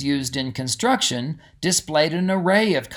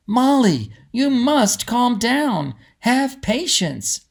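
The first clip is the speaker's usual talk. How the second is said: higher, slow soothing voice; lightly voiced patient mother; 9 semitones higher, slower than usual. Mother urging excited Molly to be patient. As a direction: ease her down gently.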